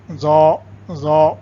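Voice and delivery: زو twice, very slow, thick z with long "aw"; heavy z sound. Shown as a demonstration. Teacher shows how this letter will sound, slow thick زو. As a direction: draw out each زو slowly and heavily. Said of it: "اور اس کی آواز ہوگی".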